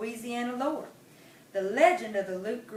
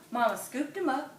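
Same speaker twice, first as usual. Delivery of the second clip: plain reading voice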